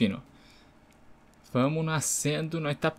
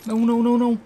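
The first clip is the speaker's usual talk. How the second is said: loud protest; 9 semitones higher, 6 dB louder, much faster than usual. Real, not acted. Player panics in the game.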